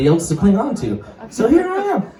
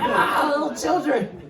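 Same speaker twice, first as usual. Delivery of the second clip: awkward dad; 10 semitones higher than usual. Retold or imitated. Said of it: "He's like".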